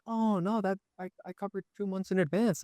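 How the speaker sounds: high, pleading tenant voice. Retold or imitated. Tenant voiced in retold argument.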